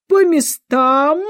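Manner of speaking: loud, high, long-drawn command; thunderous shout. A narrator's reading. Queen sends everyone to their places, thundering. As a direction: roar it out, stretching each word. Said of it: "громовым голосом крикнула королева".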